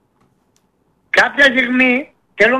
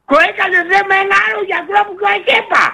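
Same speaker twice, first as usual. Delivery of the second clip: angry shouting; 8 semitones higher than usual. Real, not acted. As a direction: raise your voice in real anger. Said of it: "Μη φωνάζεις". (θυμωμένες φωνές)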